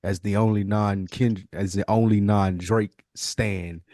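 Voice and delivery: usual speaking voice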